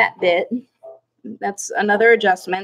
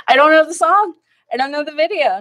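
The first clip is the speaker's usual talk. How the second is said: emphatic denial; 5 semitones higher than usual. Real, not acted. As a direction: insist firmly you don't know it. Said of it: "Nice try, [name]".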